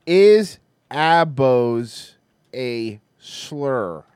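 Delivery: slow, loud, separated words; each word spaced out for a machine; dictating slowly. Real, not acted. Host retrying a search question, slow and deliberate.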